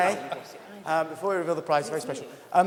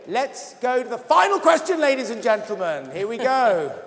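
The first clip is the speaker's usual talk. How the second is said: excited; 6 semitones higher, 6 dB louder than usual. Real, not acted. Host launching final quiz question.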